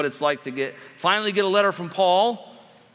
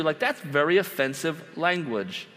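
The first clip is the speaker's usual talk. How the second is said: ordinary delivery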